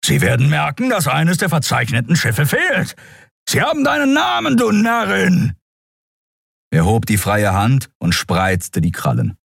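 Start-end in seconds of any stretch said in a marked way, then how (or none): 0.0-5.5 furious shout climbing in pitch; raging tirade, ever more strained; roaring. A narrator's reading. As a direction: berate the fool at the top of your voice. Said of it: "brüllte Keru".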